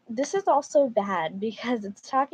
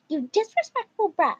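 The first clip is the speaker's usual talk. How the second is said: high, loud mock outrage; hammed-up indignant mom; entitled mom; 10 semitones higher, 6 dB louder than usual. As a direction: scold like an offended mom, pitched way up. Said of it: "Titled Mom".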